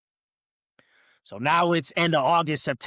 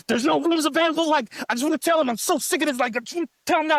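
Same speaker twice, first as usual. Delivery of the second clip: much higher, louder panicky wife; high, hammed-up panicked wife; wife freaking out; 13 semitones higher, 4 dB louder, faster than usual. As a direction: mimic the pregnant wife losing it. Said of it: "She start freaking out".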